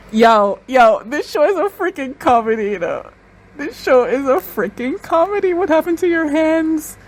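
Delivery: high, loud amazed outburst; excited disbelief. Real, not acted. Viewer shouting at the screen in amazed delight. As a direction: burst out in delighted disbelief at the show.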